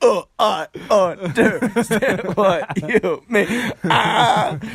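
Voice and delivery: high slow laughing echo. Pretend. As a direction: laugh through the words up high.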